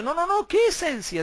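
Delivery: high scoffing no no no; mock dismissive protest; mocking opponents. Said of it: "dicen ellos".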